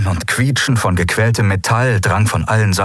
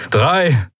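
higher-pitched count with an arching tone; commanding countdown; 7 semitones higher than usual. Acted. Admiral counting down to the final detonation, commanding and raised. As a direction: call out the number as an order.

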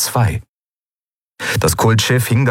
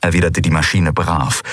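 plain reading voice

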